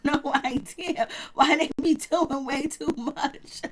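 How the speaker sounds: slow words through a real laugh, ending in giggles; can't hold back laughter at the drama. Real, not acted. Laughing at reality show antics.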